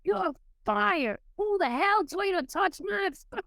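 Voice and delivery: angry boss. Retold or imitated. Boss yelling, retold.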